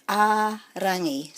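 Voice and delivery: "a rangi" with the first a held long; stress on A. Shown as a demonstration. Teacher showing the emphasis, long stressed a in "a rangi". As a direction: stretch the opening a in "a rangi". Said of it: "the emphasis is on a".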